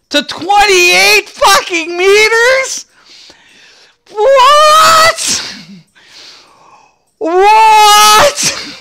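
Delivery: high cracked shouts of disbelief; shocked disbelief. Real, not acted. Gamer shouting in shock at a bow range buff. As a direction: yell at the patch note in utter shock. Reading absurd patch note range.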